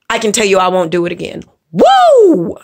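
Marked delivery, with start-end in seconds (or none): 1.7-2.6 high, loud celebratory whoop; a quick triumphant woo; excited woo. Real, not acted. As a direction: cheer yourself on. Celebrating own point.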